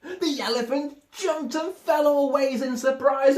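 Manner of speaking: loud, high, laughing narration; excited narration. A narrator's reading. Narrator tells how the herd jumped, laughing and loud. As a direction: tell it bursting with laughter.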